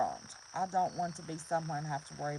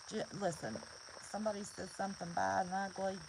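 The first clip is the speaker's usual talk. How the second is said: ordinary talk, nothing marked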